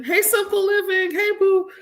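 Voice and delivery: high singsong greeting; sweet high-pitched hello; friendly shout-out. Real, not acted. Greets a viewer mid-rant.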